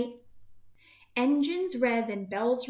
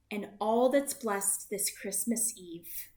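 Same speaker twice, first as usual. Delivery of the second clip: usual speaking voice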